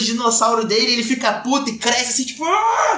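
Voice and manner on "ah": high sudden scream; mock scream. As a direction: let out a hammed-up yell. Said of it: "dá um grito". (grito exagerado de imitação)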